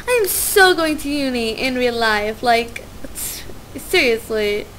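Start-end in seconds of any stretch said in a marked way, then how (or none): none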